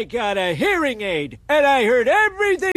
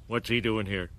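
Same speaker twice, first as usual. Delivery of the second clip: muttered aside, much softer; under the breath; 10 dB quieter than usual. Acted. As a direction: grumble it quietly under your breath. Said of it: "saying under their breath".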